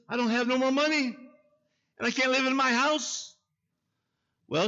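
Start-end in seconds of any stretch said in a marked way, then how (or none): 0.1-3.3 higher, tearful mock plea; imitating crying woman. Mayor imitating the broke young woman pleading, higher tearful voice. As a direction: whimper it like the distraught young woman. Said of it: "That's the way she sounded".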